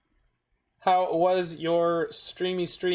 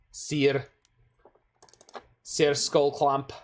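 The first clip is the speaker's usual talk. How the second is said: slow Scottish accent on Sir Skullclamp; much slower than usual. Shown as a demonstration. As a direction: roll it out slowly in a Scottish accent. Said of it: "You must say it with a Scottish accent".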